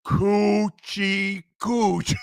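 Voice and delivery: "Coochie cooch" stretched very slowly, each syllable held; sounded out slowly. Shown as a demonstration. Told to sound it out, host drags it very slowly. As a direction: drawl out "Coochie cooch" one syllable at a time. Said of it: "Sound it out".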